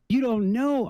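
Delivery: high, disbelieving question; high-pitched can't-believe-it retort; incredulous. Retold or imitated. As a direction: throw his answer back at him in shock. Incredulous at the hillbilly.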